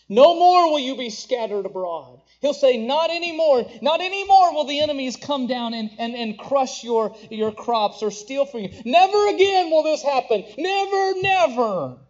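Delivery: rising to a loud, high proclamation of never again; thundering divine promise. Acted. Preacher voicing God's vow to Israel, ringing and loud. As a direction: proclaim it like God swearing an oath, building to a peak.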